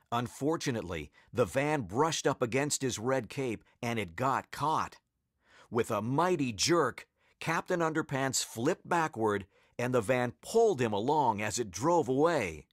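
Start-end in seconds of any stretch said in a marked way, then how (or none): none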